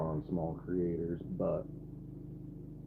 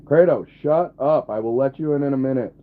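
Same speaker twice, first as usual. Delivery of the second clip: louder, higher sharp command; scolding the dog; 7 semitones higher, 11 dB louder than usual. Real, not acted. Streamer yelling at dog Kratos to shut up, loud. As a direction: tell the dog off loudly.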